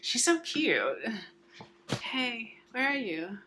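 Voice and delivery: high cooing pet voice; talking to a dog. Real, not acted. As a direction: coo at the puppy.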